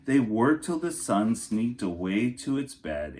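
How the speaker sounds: storybook narration for kindergartners; lilting picture-book reading voice; reading to children. Acted. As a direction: read it like story time for little kids. Reads picture book to class.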